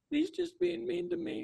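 mock Noah playing the victim; whiny victim. Mocking Noah as he plays the victim to Tayshia, whiny and high. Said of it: "Noah played super victim".

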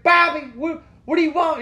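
high, piercingly loud mom holler; yelling at son. Retold or imitated. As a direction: holler the name as a hammed-up Southern mom. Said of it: "she starts yelling at the son".

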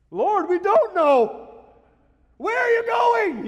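high, loud, drawn-out cry; panicked shout. Acted. Thomas crying out to Jesus in alarm, very high and loud.

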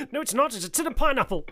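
high, loud mock-indignant correction; hammed-up loud contradiction; loud acted retort. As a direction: belt out the punchline in character. Acted punchline of the scene.

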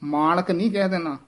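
ordinary talk

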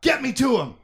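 high, loud plea; desperate shout. Acted. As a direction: yell it at your son. Han desperate to reach Chewie.

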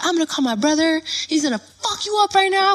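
high, heated warning; retold threat. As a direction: threaten to bring in backup.

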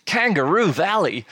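mock Australian twang on the place name; awful Australian accent. Shown as a demonstration. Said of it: "That sounds so Australian".

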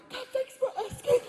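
very high, choked-up thanks; mock weepy gratitude. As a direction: overcome with emotion. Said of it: "People go".

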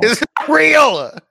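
very high, loud, stretched-out protest; mock-outraged shout. Telling co-host the paradise is fake, in a mock-outraged shout. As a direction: draw it out in an exaggerated yell.

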